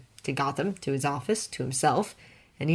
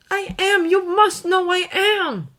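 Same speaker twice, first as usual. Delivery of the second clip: higher pleading plea; beseeching Clark; 6 dB louder than usual. A narrator's reading. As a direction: beg him to believe it. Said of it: "eye beseeching".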